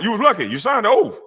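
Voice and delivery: ordinary talk, nothing marked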